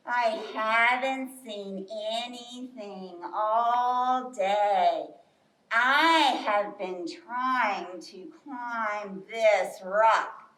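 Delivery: very slow, drawn-out low turtle voice. Acted. Turtle telling bear about climbing a rock, ponderously slow. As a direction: drag every word out at a plodding pace. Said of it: "And Turtle says".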